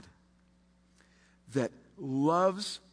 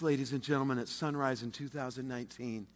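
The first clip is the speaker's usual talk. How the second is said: usual speaking voice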